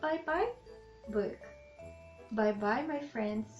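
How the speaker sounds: usual speaking voice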